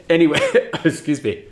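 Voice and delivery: laughing through the words; fast, laughing as you apologise. Real, not acted. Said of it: "I'm choking on air".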